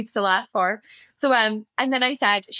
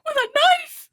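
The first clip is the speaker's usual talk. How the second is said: put-on high, louder child's alarmed question; horrified child; 5 dB louder, slower than usual. Mum imitating her daughter asking about the knife, high and alarmed. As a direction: voice the little girl's horror. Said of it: "She was like".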